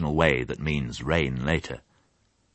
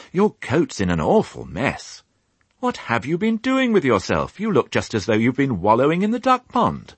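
higher, louder fussing mum; mild motherly scolding; 9 semitones higher, 3 dB louder than usual. Acted. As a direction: fuss over a muddy child. Fly scolds muddy Babe.

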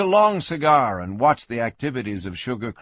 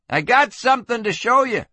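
high-pitched call from out back; 10 semitones higher than usual. Acted. Young man summoning the sheriff, eagerly calling out. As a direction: call it through to the next room.

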